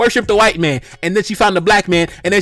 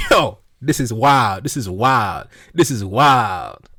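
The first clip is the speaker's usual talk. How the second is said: mock disbelief; 8 semitones lower, much slower than usual. Stunned reaction to tweets.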